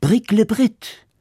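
high, slow ceremonial spell word; magic word. Acted. Miller saying the magic word to the gold donkey, high and slow. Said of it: "Der Müller sagte".

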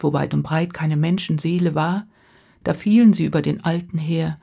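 ordinary delivery, nothing marked

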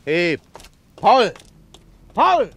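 loud, high desperate calls; frantic shout after a man running off; shouting after him. Acted. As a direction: yell the name across the dark street. Calling after the fleeing man.

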